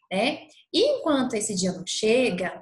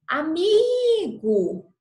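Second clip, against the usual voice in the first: AMIGO called high and loud with a long drawn-out MI; singsong call, long high MI in Amigo; calling out to a friend; 7 semitones higher, 6 dB louder, much slower than usual. Shown as a demonstration. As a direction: call "Amigo" like hailing a friend at the door, stretching the MI. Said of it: "você precisa chamá-lo".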